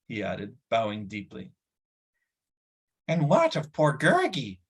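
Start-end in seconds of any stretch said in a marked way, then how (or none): 3.1-4.6 higher whining creature voice; howling creature. Acted. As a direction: wail it like a hurt little beast. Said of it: "howled the creature".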